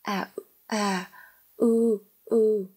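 halting, repeated filler sounds; infected stammer. A narrator's reading. Flustered owner catches the visitor's stutter, stammering. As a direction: stammer on empty syllables with pauses between. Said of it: "lây lan cái tật cả lăm".

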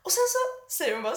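ordinary talk

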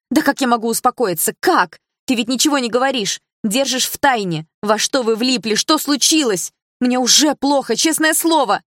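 high, loud frantic voice; almost screaming in panic; panicked near-shouting. A narrator's reading. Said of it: "Она говорила громко, почти кричала". (в панике, почти кричит)

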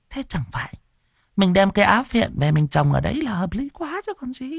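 high, fussing wife pressing her husband; scheming wife. Acted. Bá Nhu schemes over the land.

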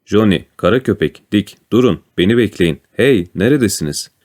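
usual speaking voice

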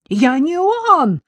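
high, loud, slow wail; wailing yell. A narrator's reading. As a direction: cry it out high and desperately. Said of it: "завопил Козловский".

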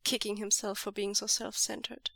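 ordinary delivery, nothing marked

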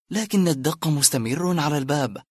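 usual speaking voice

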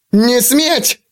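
high-pitched squealed command; panicked squeal. A narrator's reading. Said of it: "взвизгнул юноша".

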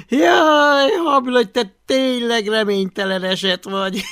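high, loud jeer through laughter; mock him while laughing; laughing taunt. (nevetve gúnyolódó hang)

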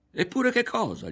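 loud, high-pitched challenge; high, pressing question. A narrator's reading. Ario asking Berto to go on, high and pressing.

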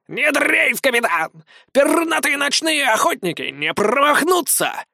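high, puffed-up proud voice; pompous colonel. A narrator's reading. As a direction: swagger like a puffed-up owl. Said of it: "раздул пузико от важности".

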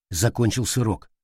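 plain reading voice

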